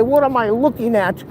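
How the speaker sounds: put-on high, scandalised old lady; a light hint of a prim fifties lady; shocked fifties lady. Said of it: "the ladies from the fifties would plot".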